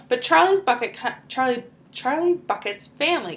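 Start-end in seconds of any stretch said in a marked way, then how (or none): none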